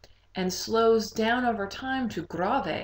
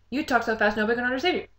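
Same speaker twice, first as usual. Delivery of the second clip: rapid-fire rush of words; vivace, very fast; 3 semitones higher, 3 dB louder, much faster than usual. Shown as a demonstration. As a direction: race through it in one burst. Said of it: "speak at a vivace tempo, a lively tempo".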